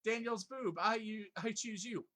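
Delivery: high, loud hammed-up Pokemon trainer shout; over-the-top trainer calling out his Pokemon; mock trainer battle cry. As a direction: yell it like a kid commanding his Pokemon. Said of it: "I'm just thinking about them being thrown into battle".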